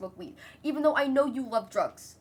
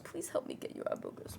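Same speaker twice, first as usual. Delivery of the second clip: breathy whisper to the cat; 14 dB quieter than usual. Real, not acted. Owner whispers to her cat about eye boogers, whispered. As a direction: whisper it close to his face.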